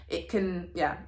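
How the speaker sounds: ordinary delivery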